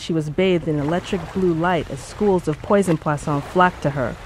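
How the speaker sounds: usual speaking voice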